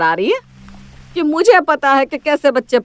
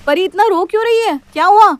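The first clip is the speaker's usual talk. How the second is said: alarmed call; 10 semitones higher, 5 dB louder than usual. Acted. Divya hears baby crying.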